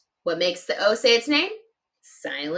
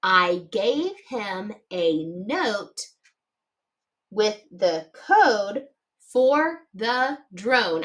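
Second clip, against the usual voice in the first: slow, word by word; slow dictation; 2 semitones lower, slower than usual. Shown as a demonstration. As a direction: read it out slowly for writing. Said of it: "should look like this".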